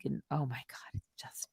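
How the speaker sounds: soft whispered groan of fatigue; real weary hush; exasperated whisper. Real, not acted. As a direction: whisper it like you're fed up. Exasperated aside.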